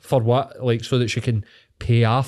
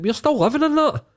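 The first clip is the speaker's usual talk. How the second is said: sudden much higher outraged pitch; indignant retort; 13 semitones higher than usual. Retold or imitated. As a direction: shoot up high in disbelief. Retorting to imagined future self.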